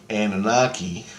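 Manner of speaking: ordinary delivery, nothing marked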